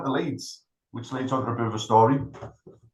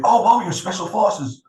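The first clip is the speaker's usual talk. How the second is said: much higher, slow, awestruck mock voice; amazed interviewer; 9 semitones higher, 5 dB louder, slower than usual. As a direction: gush at the words Special Forces. Interviewer amazed at Special Forces.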